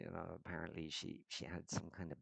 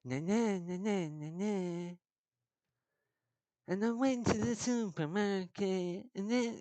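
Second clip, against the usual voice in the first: much higher, loud sing-song Limerick scumbag tune; Limerick scumbag intonation; 14 semitones higher, 9 dB louder than usual. Shown as a demonstration. Comedian demonstrating the Limerick scumbag intonation pattern, high and loud. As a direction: demo the Limerick scumbag lilt, pitched way up. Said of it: "it kind of sounds like".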